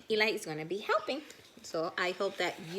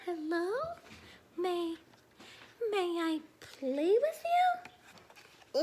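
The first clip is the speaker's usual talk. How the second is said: small high shy voice, slow; lightly voiced shy child, slow and hesitant; 8 semitones higher, slower than usual. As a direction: ask timidly, like a lonely little one. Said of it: "asks shyly".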